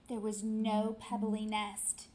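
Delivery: ordinary delivery, nothing marked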